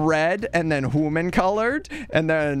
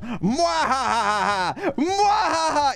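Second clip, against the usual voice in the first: evil laugh; 7 semitones higher, much slower than usual. Pretend. Drawing an evil tomato, hammed-up villain cackle. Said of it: "He's gonna be so evil".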